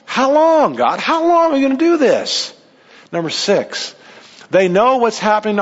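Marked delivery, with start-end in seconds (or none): 0.1-2.5 much higher, pleading upset cry; upset plea. Acted. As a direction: cry out how long, upset. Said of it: "And they're upset".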